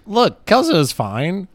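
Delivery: hammed-up mom reassurance; mom insisting. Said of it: "she goes".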